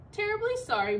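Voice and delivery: usual speaking voice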